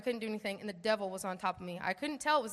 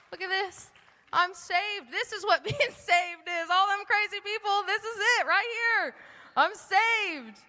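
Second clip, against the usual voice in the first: high, loud, exultant outburst; reliving a joyful revelation, voice lifted; elated discovery; 9 semitones higher, 5 dB louder than usual. Retold or imitated. New believer rising from baptism, overjoyed and exclaiming. Reliving the moment of being saved.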